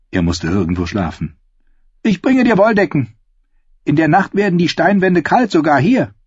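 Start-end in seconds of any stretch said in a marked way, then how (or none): 2.0-6.1 put-on much higher, louder boyish voice; eager young novice, voice pushed up; doughy youth voiced. The pale novice offers Sam a cell, in a high boyish voice.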